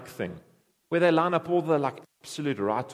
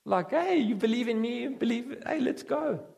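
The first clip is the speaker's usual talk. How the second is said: higher, breezy, chummy greeting; a light mock of a carefree welcome; mock casual Jesus; 9 semitones higher than usual. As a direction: play a too-easygoing buddy Jesus. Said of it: "he's just gonna be like".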